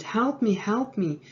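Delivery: high mock yell; short imitated cry for rescue, held back; retold scream. Trapped man hears footsteps and calls, mild mock scream. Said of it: "he heard footsteps and he screamed".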